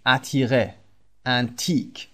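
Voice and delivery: ordinary talk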